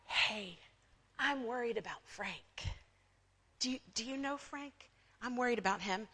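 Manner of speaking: soft, confidential gossip's voice; hushed mock concern. Churchgoer confiding in people about Frank, hushed mock concern. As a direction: lean in quietly with put-on worry.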